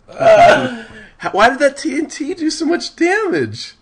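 high, loud disbelieving cry; outraged disbelief. Real, not acted. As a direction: shout it in stunned outrage. Outraged at lost health.

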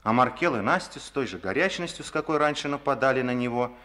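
ordinary talk

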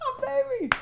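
high and loud through a laugh; laughing through tears. Real, not acted. Overjoyed at partner on the phone, a loud high laugh. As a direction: gush it while laughing.

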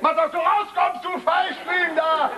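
high, drawn-out angry yell; furious shout. Acted. Father throws son out, furious shout. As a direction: yell them out of the room.

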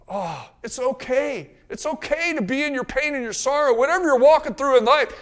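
ordinary delivery

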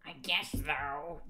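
slow, half-hearted tearful reply; mock weeping. A narrator's reading. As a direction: drag it out as if weeping.

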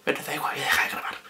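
dropping to a whisper. Real, not acted. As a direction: whisper it as you give up. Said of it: "gives up, stops recording".

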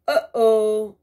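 loud, high dismayed "Uh-oh"; fairy godmother uh-oh. Acted. Fairy godmother realizing her mistake, loud and dismayed. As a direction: caught out, oops.

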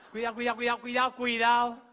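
rapid-fire cautioning; urgent warning. Pretend. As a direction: warn them off loudly. Warns as curtain is being lowered.

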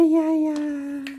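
ordinary talk, nothing marked

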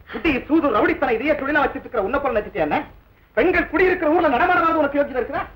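rising to a high shouted rebuke; indignant rebuke. Acted. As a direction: indignation climbing in pitch. Insulted man rebukes accuser. (ஆத்திரமான கண்டிப்பு)

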